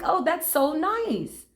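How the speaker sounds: high, slow, touched; a high, slow oh that's so nice; delighted surprise. Retold or imitated. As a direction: let the delight lift it up. Retelling free smoothie surprise.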